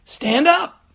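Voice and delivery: loud, high-pitched retold pastor's order; booming command. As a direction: imitate a pastor booming to wake a sleeper. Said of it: "he said this quite loudly".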